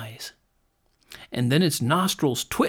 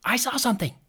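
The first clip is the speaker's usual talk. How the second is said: put-on high, excited little boy; excited cry; 10 semitones higher, faster than usual. A narrator's reading. Youngest boy to the others, high and excited. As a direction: blurt it out like a thrilled small boy. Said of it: "cried the youngest boy".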